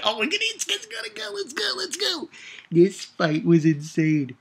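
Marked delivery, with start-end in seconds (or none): 0.0-2.3 very high, excited shouting; hyped shout. Retold or imitated. Cheering as Issei's red dragon armor appears, a high hyped shout. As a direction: scream it like a fan cheering the fight. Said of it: "I was like".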